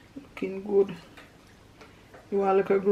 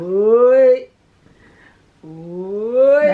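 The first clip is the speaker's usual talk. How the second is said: loud call; 3 semitones higher, 13 dB louder than usual. Real, not acted. Calling out loudly.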